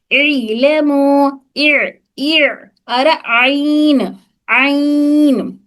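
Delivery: इलमु with a thick ain squeezed from the mid throat, very slow; thick throaty ain. Shown as a demonstration. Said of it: "आइन गाढ़ा". (गाढ़ा ऐन, बहुत धीरे)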